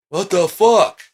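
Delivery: high, loud baffled outburst; high-pitched incredulous yell; shocked disbelief. Acted. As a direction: act a stunned viewer. Voices baffled audience.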